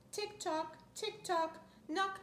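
ordinary delivery, nothing marked

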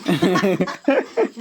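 bursting out laughing; loud laugh. Real, not acted. Laughing at shock joke.

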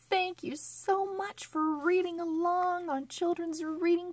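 high, fast upbeat farewell; cheerful sign-off. Acted. Host thanking children for reading along, cheerful and fast. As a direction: wrap up brightly for the kids.

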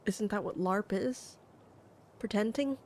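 ordinary talk

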